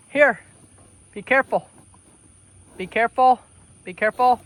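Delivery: ordinary talk, nothing marked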